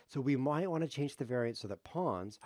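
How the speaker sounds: normal talking voice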